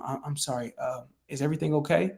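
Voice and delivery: hushed, concerned phone voice; voice brought down. Shown as a demonstration. Coach responding to a seller in hospital, hushed concern. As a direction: bring it down soft and caring. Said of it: "you would bring your voice down".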